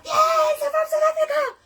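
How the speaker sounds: high, loud shout from the front row; excited heckling shout. Retold or imitated. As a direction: holler it out like a thrilled heckler. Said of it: "I'm shouting".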